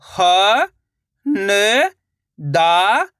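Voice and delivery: each letter sounded alone, held long; slow chant-like spelling with pauses between sounds; syllable-by-syllable reading. Shown as a demonstration. Teacher leading learners to repeat each letter, slow and separated. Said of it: "मेरे साथ साथ इनको repeat करना है".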